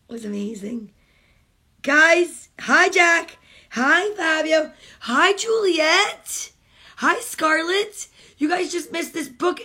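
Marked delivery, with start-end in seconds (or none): 1.8-9.6 loud, high, slow hellos; cheery greeting. Real, not acted. Mom greeting her grandkids by name, cheery.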